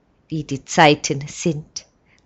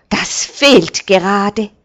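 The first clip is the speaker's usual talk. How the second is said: grumbling, scolding; 4 semitones higher, 7 dB louder than usual. A narrator's reading. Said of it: "schimpft der Knecht".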